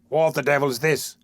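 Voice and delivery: gruff demand. A narrator's reading. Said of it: "said a man bursting out".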